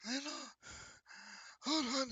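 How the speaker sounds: gasped out in a high voice; high, airless fish-man gasp; struggling to breathe. Acted. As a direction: barely get the words out between breaths. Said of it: "struggling breathing".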